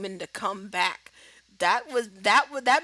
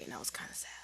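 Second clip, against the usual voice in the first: whisper; 17 dB quieter, much faster than usual. Real, not acted. Hushed aside.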